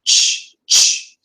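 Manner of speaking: crisp unvoiced ch bursts; sharp ch. Shown as a demonstration. Teacher showing the stronger ch sound. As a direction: snap out ch, ch. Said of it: "the sound is a little bit stronger".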